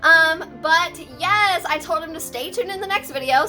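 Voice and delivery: usual speaking voice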